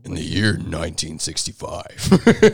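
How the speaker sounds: slow, grand story opening; storyteller voice. Shown as a demonstration. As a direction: play the old-time storyteller. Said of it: "it was a joke".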